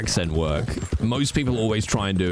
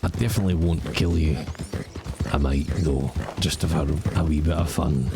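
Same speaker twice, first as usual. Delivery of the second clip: low, pleading beggar voice; creepy starving beggar; 5 semitones lower than usual. Pretend. Freezing beggar asking strangers for a dollar and stew, low pleading.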